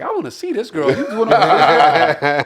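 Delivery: high, loud imitation of an excited mother; funny mother. Son imitates his mother demanding to see his date, high and loud. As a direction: send the voice way up, drawing out the end. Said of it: "My mother's like".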